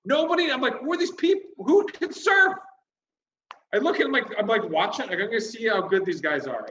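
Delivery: high, incredulous comic outrage; exasperated rant. Retold or imitated. Comedian sees nobody can surf, high exasperated rant. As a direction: build to a high-pitched peak, then ease down.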